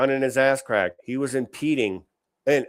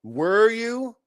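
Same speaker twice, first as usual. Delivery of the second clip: sarcastic jab; 10 semitones higher, much slower than usual. Acted. Mocking the caught protester.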